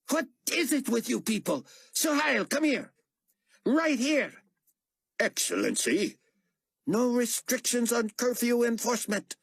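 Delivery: furious barrage; ranting fury. A narrator's reading. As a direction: rant at his people. Carpathia rants at staff.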